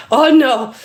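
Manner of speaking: high, loud protest; nearly losing it. Retold or imitated. Woman as he sits down uninvited, nearly losing it. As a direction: object sharply as patience runs out. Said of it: "That's when I almost lost it".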